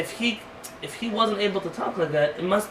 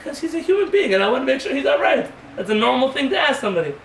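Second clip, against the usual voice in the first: higher, louder insistence; insistent husband; 7 semitones higher, 4 dB louder than usual. Retold or imitated. Husband defending himself to his wife, higher and louder. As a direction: insist it's normal, higher.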